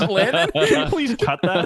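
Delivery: high, slow, cracking up; grossed out and laughing hard; laughing in disgust. Real, not acted. Grossed out by Adam's apple trick.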